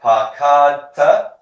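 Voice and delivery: slow, loud, high, rising at the end; repeat-after-me model. Shown as a demonstration. Teacher leading a class drill, slow loud rising model word. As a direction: stretch it out for the class to echo. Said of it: "Repeat after me".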